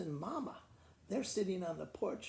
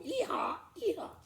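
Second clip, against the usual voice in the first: a lightly voiced donkey bray, pitched higher; animal call; 10 semitones higher, 5 dB louder than usual. Teacher doing the strange cows' call for the kids, mock bray. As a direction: bray it like a silly farm animal. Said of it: "cows with unicorns that go".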